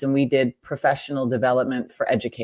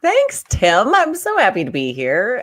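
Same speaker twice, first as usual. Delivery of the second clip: excited greeting; 7 semitones higher, 5 dB louder than usual. Real, not acted. Guest greeted on the podcast.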